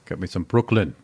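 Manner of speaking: put-on joking New York accent. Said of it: "I like a good New York accent".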